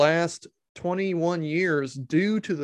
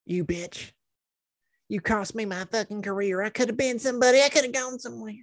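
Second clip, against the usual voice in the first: hammed-up bitter woman, higher by the end; mock bitter rant; 6 semitones higher, faster than usual. The host imagining her threats to Melissa Joan Hart.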